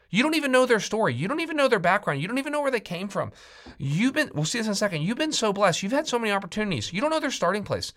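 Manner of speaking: urgent, high-pitched preacher's rebuke; impassioned scolding. Acted. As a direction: voice Paul taking judgers to task. Acts Paul scolding the judgmental.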